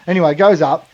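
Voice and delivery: ordinary talk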